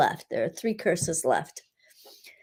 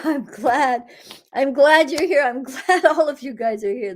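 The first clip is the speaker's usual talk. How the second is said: higher, bright with laughter; glad; 6 semitones higher, 5 dB louder than usual. Real, not acted. Healer thanking the group for being there, higher and laughing. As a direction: welcome everyone with laughing joy.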